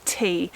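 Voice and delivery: T said as plain tee; a simple T. Shown as a demonstration. Teaching hikers the first word, plain tee. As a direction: clean tee like t-shirt. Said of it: "not tay or t".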